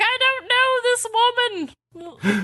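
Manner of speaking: put-on high, slow old man's plea, breaking into a laugh; old man pleading. Old man clinging to a tree begging strangers, high and slow. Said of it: "he's like".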